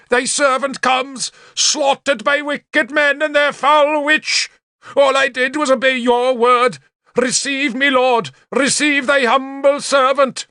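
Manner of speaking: high, sustained martyr's cry; bellowed prayer. A narrator's reading. Bishop expecting death offers himself to God, high bellowing. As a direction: declaim it to heaven like a chant, pitch held high. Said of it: "Sansom bellowed".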